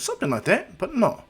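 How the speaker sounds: high-pitched acted reply; light hedging. Naruto answers lightly, voice raised.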